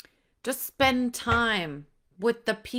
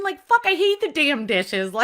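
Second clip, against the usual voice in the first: exasperated outburst; 7 semitones higher, 6 dB louder than usual. Real, not acted. Venting about hating dishes.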